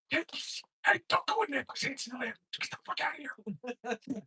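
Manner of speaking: high, soft urgent hiss-free warning; stage-whisper-like plea; warning Hansen from behind a tree; hushed warning. Acted.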